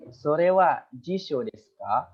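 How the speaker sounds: slow, clear model sentence for students; slow demonstration. Shown as a demonstration. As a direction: say it slowly so the students can repeat it. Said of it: "Apakah itu kamus?". (contoh pelan dan jelas untuk murid)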